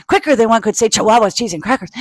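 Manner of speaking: rattled off fast. Shown as a demonstration. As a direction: say it as fast as you can. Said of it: "Can you say".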